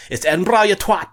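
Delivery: Scottish passer-by yells "Edinburgh" as EDIN-bra, furious; angry Scottish correction. Retold or imitated. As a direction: shout it at him as a passing Scot. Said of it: "a Scottish guy go".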